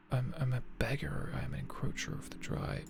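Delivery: softly whispering the riddle; whispered. Real, not acted. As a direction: whisper it under your breath.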